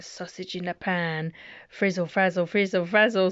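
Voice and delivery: ordinary delivery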